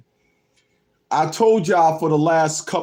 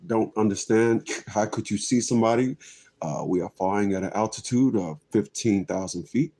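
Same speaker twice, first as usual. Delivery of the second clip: low, soft cockpit announcement; halting pilot voice with lots of "uh"; airline pilot; 11 semitones lower, 8 dB quieter than usual. Acted. Pilot to a passenger in the third seat, low halting announcement.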